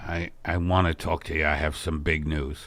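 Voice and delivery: a low, mellow middle-aged husband easing into news; Al's low voice. Acted. Husband calling his wife in to break big news, low and soft. Al voice of two-voice sketch.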